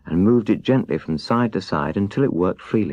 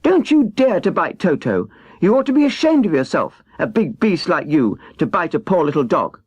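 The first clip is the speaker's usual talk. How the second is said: scolding cry; 9 semitones higher than usual. Acted. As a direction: tell off the big beast fiercely. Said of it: "while she cried out".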